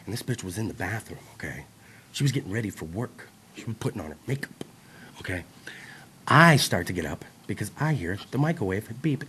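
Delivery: hushed storytelling voice. Acted. Comic telling the crowd a creepy story in a hush. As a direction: lean in and tell it low and secret.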